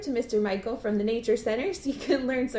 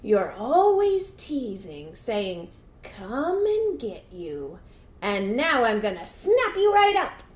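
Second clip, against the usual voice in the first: slow, stretched words with sudden emphasis; slow storybook villain; menacing alligator; 5 dB louder, slower than usual. Acted. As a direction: draw out the threat like a hungry gator. Alligator threatens trapped dog.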